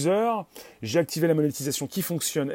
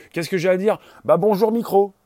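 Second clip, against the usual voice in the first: louder, higher mock stage greeting; playful excitement; 4 semitones higher, 6 dB louder than usual. Host acts out greeting the microphone, playful excitement. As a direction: greet the mic with childlike gusto.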